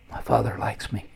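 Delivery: soft, half-whispered realization; quietly, as if taking it in; hushed. Real, not acted. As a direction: let it drop almost to a whisper. Moved author repeats cherished realization.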